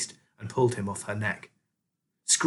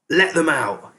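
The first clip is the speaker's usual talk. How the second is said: sharp rat shout, raised and louder; 5 semitones higher, 5 dB louder, slower than usual. A narrator's reading. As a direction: yell it up at the cages. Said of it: "he shouted up to Keith".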